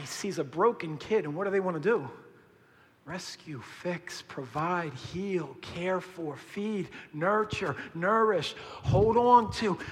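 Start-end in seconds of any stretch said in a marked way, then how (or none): 3.1-8.6 soft listing. Acted. Sermon list built slowly. 8.8-9.8 building to a cry. Acted. Sermon list rises to climax.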